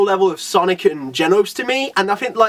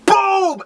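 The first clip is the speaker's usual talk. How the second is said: impact sound effect; 12 semitones higher than usual. Retold or imitated. Impact of a one-hit punch.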